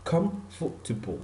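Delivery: "comfortable" broken softly into three beats; three separate syllables. Shown as a demonstration. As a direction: say "comfortable" piece by piece as comf-ter-ble. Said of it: "comfortable has three syllable".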